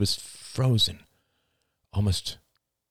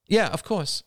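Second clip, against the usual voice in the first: quick reflex reply, higher; automatic agreement; 12 semitones higher than usual. A narrator's reading. Rachel brushing past Ian's excuse, automatic. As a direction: agree without stopping to think. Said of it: "said Rachel automatically".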